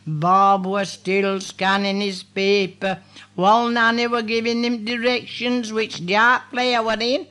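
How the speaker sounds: ordinary delivery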